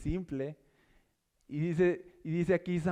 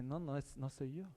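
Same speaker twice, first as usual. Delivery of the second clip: much softer, hushed correction; Elí explaining softly; 2 semitones lower, 11 dB quieter than usual. Pretend. Elí quietly telling Samuel it is God, hushed. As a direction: gently tell him it's God. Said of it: "él le dice".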